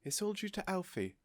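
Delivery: a little out of breath; breathy with joy; slightly breathless. A narrator's reading. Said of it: "slightly breathless".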